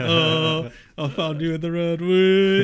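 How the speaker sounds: high, loud, climbing whoop; going off. Retold or imitated. Dad's mate who found him in road, imitated crowing. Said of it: "proper going off about this".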